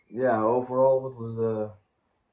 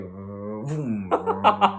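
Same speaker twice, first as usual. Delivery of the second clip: engine vroom; 3 dB louder than usual. Retold or imitated. Friend mimics the e-bike suddenly boosting, playful engine noise. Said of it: "It was just going like".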